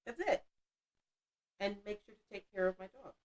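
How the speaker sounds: normal talking voice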